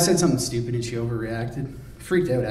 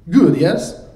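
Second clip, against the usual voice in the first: put-on loud, much higher accented boast; proud boast; 6 semitones higher, 10 dB louder than usual. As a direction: push for praise. Drago boasting about his weed.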